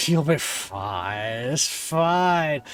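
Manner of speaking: put-on very slow, drawn-out drunken speech; drunken prophet. Drunk prophet advising customer about dragon fire, dragged out. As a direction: stretch words like a slumped barfly. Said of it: "After saying this".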